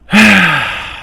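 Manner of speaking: loud high sigh. Real, not acted. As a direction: let out a big audible sigh. Sigh before new topic.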